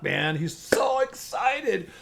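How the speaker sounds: ordinary talk, nothing marked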